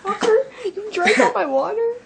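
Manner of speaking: high, loud laughing accusation; half-laughing, half-furious at a friend; squealing with laughter while accusing; laughing outrage. Real, not acted. Friend accuses her over water.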